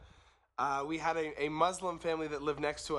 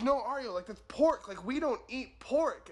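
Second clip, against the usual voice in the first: strict mother; 6 semitones higher than usual. Retold or imitated. Mother forbids son eating pork.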